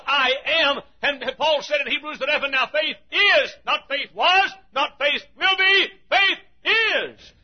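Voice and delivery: shouted, high preaching peak; fiery pulpit shout; preaching at full force. Real, not acted. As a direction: hammer the point home to the congregation. Preaching climax.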